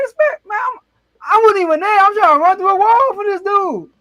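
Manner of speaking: like you'd run through a wall for him; retold hype. Hyped by the emotional speech.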